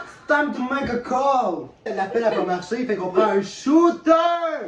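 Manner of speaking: slow, loud announcer voice; game show host. Acted.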